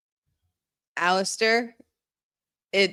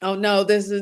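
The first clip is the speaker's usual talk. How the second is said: ordinary talk, nothing marked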